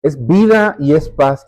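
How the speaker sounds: ordinary delivery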